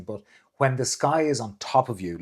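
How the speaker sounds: usual speaking voice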